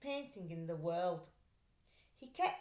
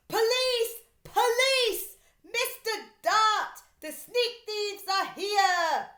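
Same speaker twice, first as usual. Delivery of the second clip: much higher, much louder alarm cry; loudest terrifying shout; 13 semitones higher, 13 dB louder, slower than usual. Acted. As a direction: yell for the police at full force. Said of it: "shouted in his loudest, most terrifying voice".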